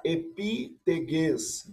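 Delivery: epiteges, spelled out very slowly; syllable by syllable, epiteges. Shown as a demonstration. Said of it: "sobre a terra".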